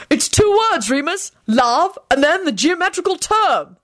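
high-pitched calling out; shouted hint. A narrator's reading. Arthur prompting Remus with a clue, shouting out. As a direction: call the hint out high and insistent. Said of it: "Arthur shouted out".

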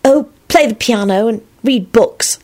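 high, slow, musing reply; thoughtful. A narrator's reading. Elizabeth answering about her favorite things, slow thoughtful voice. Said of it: "Elizabeth replied thoughtfully".

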